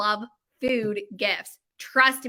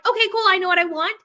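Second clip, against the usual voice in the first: eager customer; 7 semitones higher, 7 dB louder than usual. Retold or imitated. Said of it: "if you're like".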